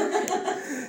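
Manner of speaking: a quick high laugh at himself; short laugh. Real, not acted. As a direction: laugh off the silly example. Laughs at own dumb example.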